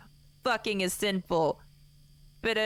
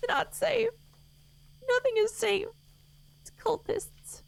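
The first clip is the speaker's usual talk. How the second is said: much higher panicked cry; panicked squeal; 14 semitones higher than usual. Real, not acted. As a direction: squeak it out in horror. Rising panic in game.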